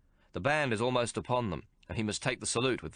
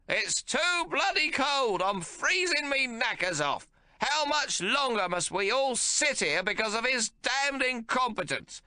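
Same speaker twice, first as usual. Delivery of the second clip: put-on high, loud, slow cranky old man complaining; cantankerous old father fuming; irascible old man; 3 dB louder, slower than usual. A narrator's reading. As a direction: grumble like a furious old codger. Old man figure held.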